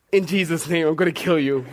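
joking holy threat; mock murderous, laughing. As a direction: fake menace to the pastor, laughing. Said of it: "I looked at my pastor and I said".

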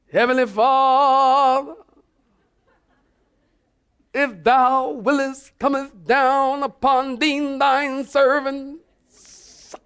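mock-solemn prayer voice, high, loud and drawn out; changed praying voice. Shown as a demonstration. Preacher showing his church a put-on praying voice, exaggerated and grand. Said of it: "You don't have to change your voice".

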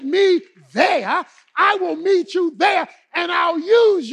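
high, loud pulpit shouting; full-force preaching. Real, not acted. Preacher promising God will meet you where you are, fervent. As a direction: build the climax with ringing, punched phrases.

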